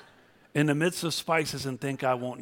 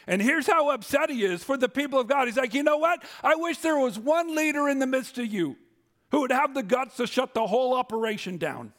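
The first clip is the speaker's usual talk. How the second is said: high, loud preaching about fake butter; preaching at full force; 10 semitones higher, 4 dB louder than usual. Real, not acted. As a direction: drive it home from the pulpit, high and loud. Preacher voicing God upset.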